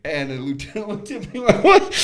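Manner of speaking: high, loud, cracking up while talking; barely holding the story together for laughing. Real, not acted. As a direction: tell it through bursts of laughter.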